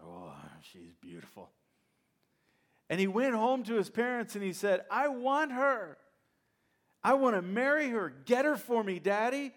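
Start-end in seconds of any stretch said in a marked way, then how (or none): 0.0-1.5 smitten Samson. Acted. Samson admiring a Philistine woman. 4.9-9.5 whiny demanding son. Acted. Samson demanding a wife from parents.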